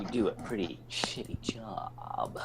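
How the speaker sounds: a hint of Snake, slowed down. Player voices Snake again.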